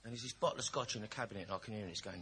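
ordinary delivery

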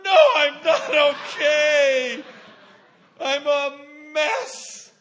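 high, loud, slow wailing admission; loud confession. Retold or imitated. Son admitting to the friend he is a mess, high loud slow wail. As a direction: let it burst out, half laughing.

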